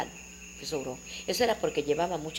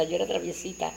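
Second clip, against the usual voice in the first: ordinary talk, nothing marked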